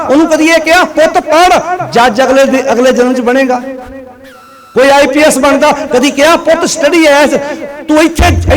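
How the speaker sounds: high, insistent sermon questioning; forceful, high-pitched oratory; impassioned preaching. Real, not acted.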